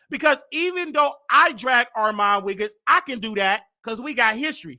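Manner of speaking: ordinary talk, nothing marked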